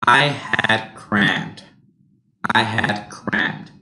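I had crammed slowly, stress on I; slow demo. Shown as a demonstration. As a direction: say it slowly, leaning on I. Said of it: "make sure you go up".

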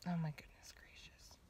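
breathy whispered aside. Real, not acted. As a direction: whisper it to yourself. Quiet aside in car.

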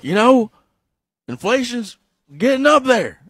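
high, loud, halting politician parody; hammed-up presidential address; Joe Biden. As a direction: chop it into short loud bursts with pauses. Acting Biden blowing bubbles at meeting.

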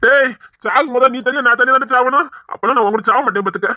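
usual speaking voice

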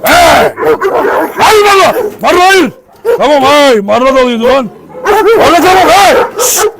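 loud, high commands to stop; urgent shouting to stop the dog. Real, not acted. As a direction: yell at the dog to back off. Yelling to stop attacking dog.

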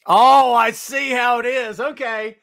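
slow, loud mock-offended retort; hammed-up wounded reply; mock offense. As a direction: act hurt for laughs.

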